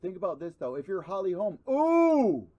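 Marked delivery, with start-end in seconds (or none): 1.7-2.5 loud, high startled cry; surprised ooh. Real, not acted. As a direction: jolt at the sudden hit. Reacting to a landed kick.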